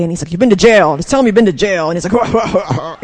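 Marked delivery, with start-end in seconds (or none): 0.3-1.9 loud, blunt fatherly retort; imitating dad. Dad telling his daughter she qualifies, loud and dry. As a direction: throw it back at her like a dad.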